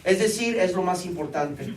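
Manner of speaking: loud, high, urgent preaching from the pulpit; fervent sermon climax; full-force preaching. Acted. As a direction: preach it out to the congregation, pressing hard.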